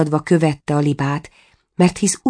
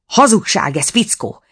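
sharp angry rebuke, pitched up; snapping at him; 5 semitones higher than usual. A narrator's reading. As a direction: bite at the dwarf for lying. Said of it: "ripakodott rá a herceg". (rárivalló, dühös herceg)

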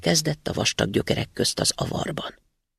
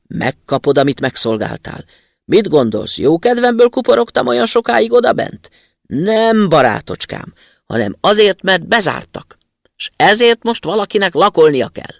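booming vengeful spirit; 5 semitones higher, 7 dB louder than usual. Acted. Spirit lectures its victim.

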